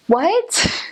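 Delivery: high, rising, baffled "What?"; totally lost, ask it sharply upward. Retold or imitated. Hearing clipped slang, confused.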